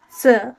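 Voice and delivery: Se dropping sharply in pitch; fourth tone. Shown as a demonstration. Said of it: "fourth tone going down".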